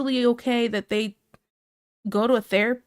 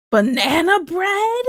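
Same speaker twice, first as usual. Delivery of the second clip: high, loud, drawn-out delighted question; excited disbelief; 7 semitones higher, 4 dB louder, slower than usual. Real, not acted. Streamer reacting to talk of banana bread, excited disbelief. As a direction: stretch it out, pitch leaping up at the end.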